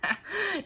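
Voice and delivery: a knowing, menacing little chuckle; dark chuckle. A narrator's reading. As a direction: chuckle darkly to yourself. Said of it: "The giant chuckled darkly".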